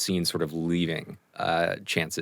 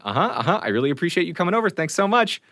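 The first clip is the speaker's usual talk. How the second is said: fast, much higher yes-yes appeasing; placating Chance; 11 semitones higher, 3 dB louder, faster than usual. Acted. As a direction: rush the thanks to calm him down. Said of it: "Chance is just sort of standing there going".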